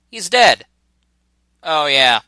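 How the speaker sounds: ordinary delivery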